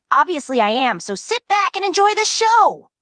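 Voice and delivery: smug Jax. Retold or imitated. Narrator imitating Jax bragging before the launch, smug. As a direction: sell it like a cocky ringmaster.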